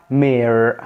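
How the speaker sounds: "مير" in American accent, R sounded. Shown as a demonstration. Teacher contrasting accents for viewers, American-accented "مير". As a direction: hit the final American R in "مير". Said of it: "لو هننطقها بالامريكان اكسنت".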